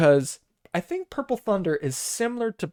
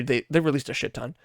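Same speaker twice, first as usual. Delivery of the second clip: usual speaking voice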